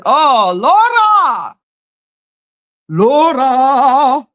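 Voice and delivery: long, drawn-out call of the name. Pretend. Streamer calling to the persistent caller, calling out. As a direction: stretch the name out in a mock call.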